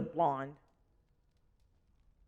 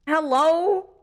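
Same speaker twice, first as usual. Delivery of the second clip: high-pitched disbelieving hello; incredulous; 9 semitones higher than usual. Real, not acted. Player questioning the approval score, incredulous. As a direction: call it out high, as if questioning reality.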